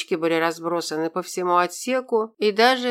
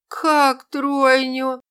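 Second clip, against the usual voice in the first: stunned disbelief; 7 semitones higher, 5 dB louder, much slower than usual. Acted. Shocked voice from under debris.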